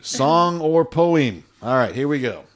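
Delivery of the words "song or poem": slow, loud, drawn-out announcement; announcer voice. Pretend. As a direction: announce it like a game show. Game-show style announcement.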